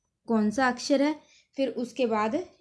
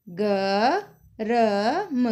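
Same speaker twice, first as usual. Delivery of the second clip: slow sounding out; 5 dB louder, much slower than usual. Shown as a demonstration. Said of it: "अक्षरों को जोड़ के उनके शब्द बनाने हैं".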